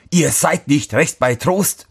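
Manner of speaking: high-pitched exclamation without much volume; mild narrated outcry; calling out. A narrator's reading. Cobbler rebukes the farmer at the wagon, calling out in mild outrage. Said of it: "rief er".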